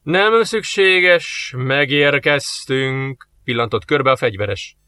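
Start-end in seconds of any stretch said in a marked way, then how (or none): 0.0-3.3 very slow, unhurried; slow calm reply. Acted. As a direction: answer slowly, in no hurry. Soldier answers calmly.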